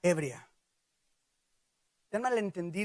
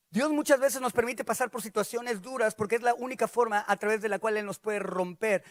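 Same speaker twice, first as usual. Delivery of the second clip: usual speaking voice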